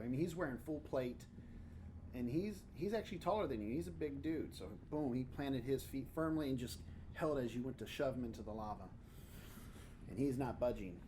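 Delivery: normal talking voice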